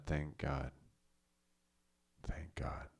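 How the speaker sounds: relieved whisper; whispered relief. Retold or imitated. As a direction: breathe it out softly. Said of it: "Susan's probably like".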